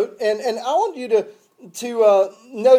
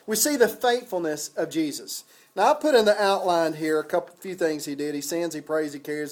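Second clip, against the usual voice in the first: ordinary delivery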